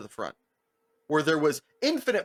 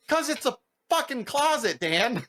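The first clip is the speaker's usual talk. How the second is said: high incredulous mock exasperation; exasperated; 10 semitones higher than usual. Friend telling Dan the jacket is in the closet, exasperated. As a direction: throw up your hands at him.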